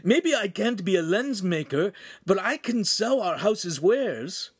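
high, eager character voice; enthusiastic apprentice. Acted. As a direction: offer yourself keenly to the master.

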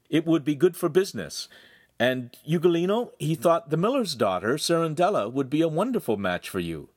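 lightly voiced persuasive young man; affable brother. A narrator's reading. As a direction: talk up a match cheerfully. Voices Handsome selling the match.

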